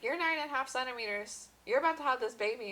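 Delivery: excited nurse. Retold or imitated. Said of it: "they're like".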